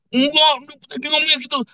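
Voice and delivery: squeaky-high acted denial; mock insistence. Acted member insisting on misheard words. (menirukan orang ngotot bersuara melengking)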